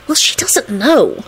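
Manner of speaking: reply breezily, drawing out the words; light cheerful singsong. A narrator's reading. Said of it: "Solange replied cheerfully".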